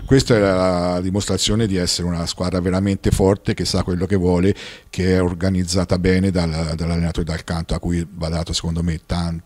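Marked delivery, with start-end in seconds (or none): none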